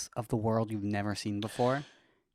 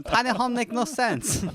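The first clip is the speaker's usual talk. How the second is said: high, broken-English mock accent; mock foreign accent, high; 10 semitones higher than usual. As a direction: drop the grammar in a silly high accent. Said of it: "don't do that accent".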